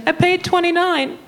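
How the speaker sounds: high, protesting mom impression; put-on high indignant mom voice; mom's voice. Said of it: "she's like".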